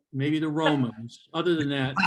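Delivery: ordinary delivery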